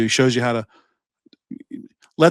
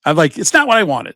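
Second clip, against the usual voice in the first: stubborn protest; 7 semitones higher, 4 dB louder than usual. Retold or imitated. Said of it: "And I was like".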